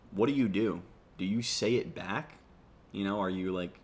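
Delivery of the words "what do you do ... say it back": normal talking voice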